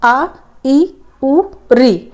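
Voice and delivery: आ, ई, उ, री said short and crisp; short vowels sounded out. Shown as a demonstration. Teacher demonstrating the short vowels to the class. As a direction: clip each short vowel cleanly. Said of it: "बोल के आप लोग देखिए".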